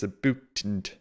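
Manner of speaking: put-on lightly done Canadian accent, a boot for about; a quick teasing Canadian accent; mock Canadian accent. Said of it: "He's not talking like this".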